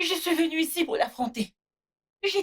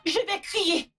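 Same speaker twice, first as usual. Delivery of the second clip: high-pitched defiant outburst; defiant and worked up; raised voice; 5 semitones higher, slower than usual. Acted. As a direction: threaten to cry out, pitch jumping up. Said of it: "ne crie pas".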